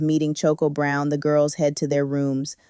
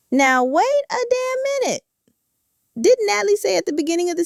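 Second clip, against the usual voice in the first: jumps much higher in disbelief; surprised protest. Real, not acted. Calling out the CEO's broken promise, shocked and high. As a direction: stop everything, shocked. Catching the room contradiction.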